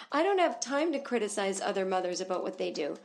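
a hint of a prissy mom voice; lightly voiced commenter lady. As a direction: brush off the criticism primly. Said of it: "live, laugh, love lady is here".

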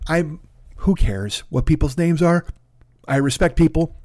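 ordinary talk, nothing marked

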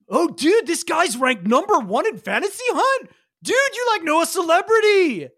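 high, giddy gushing; rising thrill over a famous contact; excited surfer kid. Acted. Teen player gushing to his friend, high and giddy.

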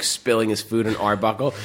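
usual speaking voice

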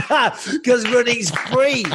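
relishing the joke; drawn-out punchline. Retold or imitated. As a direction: stretch the punchline out with glee. Repeating the punchline slowly, higher.

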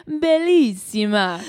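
put-on slow, loud Italian-accented Bellissima. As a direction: roll it out like a proud Italian. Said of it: "if you are Italian and you say".

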